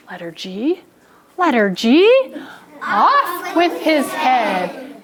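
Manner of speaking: pompous musing building to a drawn-out decree; mean king. Acted. Teacher playing the mean king judging G and N, hammed-up. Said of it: "along came that mean king".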